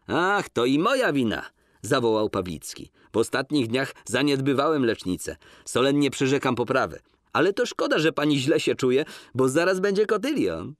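lightly voiced jovial doctor; Pawlicki's voice. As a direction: apologise cheerfully. Pawlicki's lines in character.

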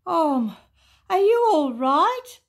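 high, loud, worried question; loud, high-pitched concern; alarmed Boo-hoo. A narrator's reading. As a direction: call out anxiously in a high voice. Boo-hoo checks on kicked Toto.

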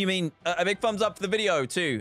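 usual speaking voice

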